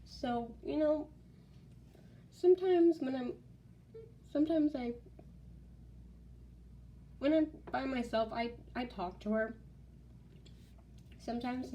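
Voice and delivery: real, choked-up halting speech; high, wavering voice with long halts; teary-eyed. Real, not acted. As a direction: struggle to get the words out through tears. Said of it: "I'm getting a little teary-eyed".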